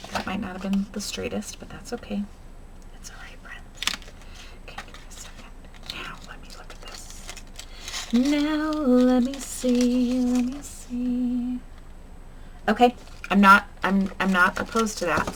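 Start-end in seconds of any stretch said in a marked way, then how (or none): 0.1-7.2 hushed, fading to a whisper; whispered self-talk. Real, not acted. Crafter whispering to viewers after a crooked strip, hushed. 8.1-11.6 drawn out slowly; slow musing. Real, not acted. Crafter slowly musing over her layout, drawn out.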